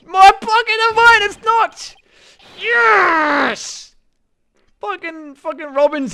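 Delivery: high, loud, stretched-out yell; excited scream. Real, not acted. Player shocked by the story reveal, screaming. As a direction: scream in disbelief at the twist. Reacts to twist on screen.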